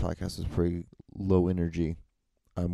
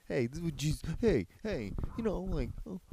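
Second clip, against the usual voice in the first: high, hammed-up imitation of Jesus; mock Jesus calling someone over, high and over the top; 11 semitones higher than usual. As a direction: play a breezy high-voiced Jesus, mocking. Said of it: "Jesus said, like".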